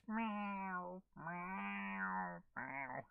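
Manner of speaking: drawn-out meows stepping down in pitch; playful little cat meow. Pretend. Greets viewer Melody the cat.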